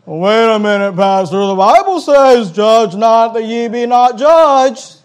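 high, loud, slow singsong quoting; mocking know-it-all. Pastor mocking a congregant quoting Judge not, singsong. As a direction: parody a smug churchgoer, drawn out. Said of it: "The only verse you have memorized".

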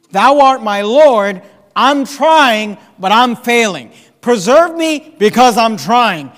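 loud, rising preacher's cry, hammering each although; full-force preaching. Acted. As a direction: preach it out, pleading for the congregation. Preaching climax on preserve me.

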